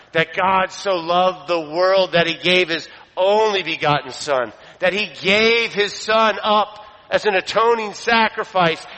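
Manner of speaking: high-pitched preaching, building and sustained; impassioned preaching. Real, not acted. Preacher proclaims the Son given for sinners, impassioned. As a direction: preach it with rising fervor.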